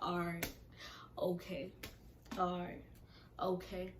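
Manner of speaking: even, unbothered one-word replies; nonchalant. Retold or imitated. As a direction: toss off each reply with no interest. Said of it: "every nonchalant response".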